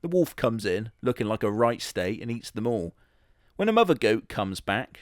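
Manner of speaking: ordinary talk, nothing marked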